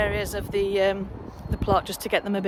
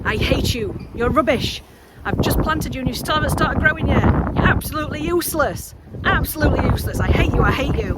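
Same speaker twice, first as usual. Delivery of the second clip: hammed-up scolding, higher; mock hate; 6 semitones higher than usual. Gardener scolding the seeds she just planted, mock angry. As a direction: berate the seedlings in mock disgust. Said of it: "we've done the love-hate veg".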